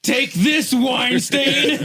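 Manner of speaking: loud playful shout at a stain; hammed-up yell while laughing; mock yell. Acting out carpet beating.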